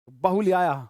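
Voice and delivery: reel shout. Retold or imitated. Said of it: "वो वाली reel देख रहे".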